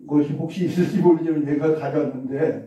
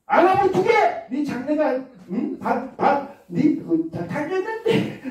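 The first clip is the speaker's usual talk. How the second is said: much higher, exasperated nagging mother; scolding parent; 10 semitones higher than usual. Acted. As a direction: lay it on like a frantic nagging mom. (잔소리하는 엄마 말투)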